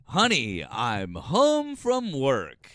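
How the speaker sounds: loud, high, very slow mock proclamation; mimicking the caller's voice. Imagining the caller home from work, loud mock voice. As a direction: boom each word out, stretched and high. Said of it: "imagine if he talked like that all the time".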